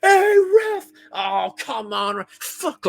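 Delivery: very high, loud squeal at the ref, then slow moaning; hammed-up diver crying to the ref; mock whiny shriek. Mimicking Sané whining after being touched. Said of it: "He gets touched".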